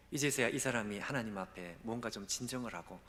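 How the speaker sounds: ordinary delivery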